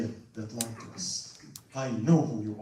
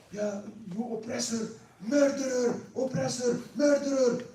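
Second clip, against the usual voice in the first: high, loud retold screaming; woman yelling at the sultan, loud and high; yelling insults; 10 semitones higher, 4 dB louder than usual. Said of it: "started yelling at Salah ad-Din".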